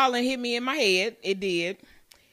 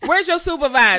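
loud, high, entitled "let me speak to the supervisor" voice; pushy high-pitched complaint; demanding customer voice; 6 semitones higher, 9 dB louder than usual. Pretend. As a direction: play the customer demanding a manager. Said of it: "this tone".

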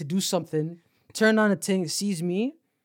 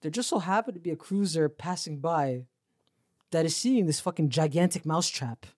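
ordinary delivery

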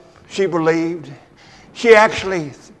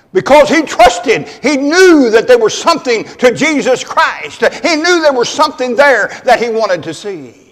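fervent preaching; 6 semitones higher, 7 dB louder than usual. Acted. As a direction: drive home the point. Preaching at full force.

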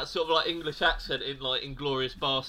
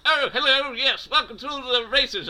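put-on old RP accent; 9 semitones higher, 4 dB louder than usual. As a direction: put on an old-fashioned posh RP voice. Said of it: "I can sort of do, one from that era".

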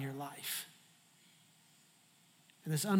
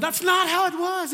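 high, loud whiny protest; protesting Jonah; 12 semitones higher, 10 dB louder than usual. Retold or imitated. Jonah in heaven protesting his story, high whine. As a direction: whine it like a wronged kid. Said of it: "Jonah's probably up in heaven going".

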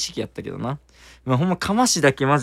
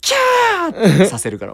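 mock scream; 10 semitones higher, 7 dB louder than usual. Joking about guests screaming.

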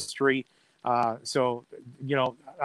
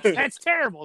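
high mock disapproval, a little louder; mock critic; 9 semitones higher, 4 dB louder than usual. Critics scoffing at the catchphrase, mock disdain. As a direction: play the snooty critic. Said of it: "And I know that's like".

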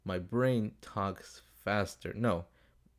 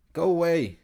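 loud yell out the window; shout; 8 semitones higher, 9 dB louder than usual. Real, not acted. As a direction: yell at the bird to leave. Shouting at bird outside window.

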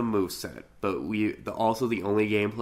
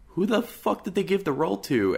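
mock outrage; 11 semitones higher than usual. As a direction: pitch jumps way up in retold disbelief. Retold outraged actor on phone.

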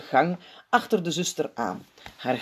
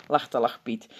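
ordinary delivery

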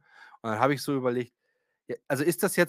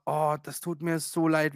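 slow, drawn-out sorry; apologetic; slower than usual. Retold or imitated. Absent doctor apologising on the phone, slow and drawn out. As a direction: stretch the apology out slowly. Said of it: "hat sie erst mal".

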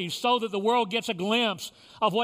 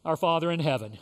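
leading the Lord's Prayer with long pauses; short phrases with pauses; 6 semitones lower than usual. Real, not acted. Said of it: "I will say a phrase".

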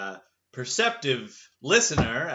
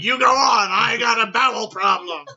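higher, much louder strained yell; JFK shouting; 8 semitones higher, 10 dB louder than usual. Retold or imitated. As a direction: bellow it like a president in distress. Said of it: "He's like".

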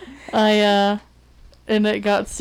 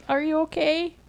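high-pitched mock concern; snickering concern; 8 semitones higher, 4 dB quieter than usual. Friend checking on someone who fell, high amused concern. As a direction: imitate a friend checking on you while amused. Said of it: "laughed and also been like".

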